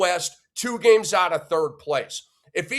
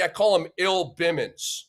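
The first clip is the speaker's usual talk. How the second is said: ordinary talk, nothing marked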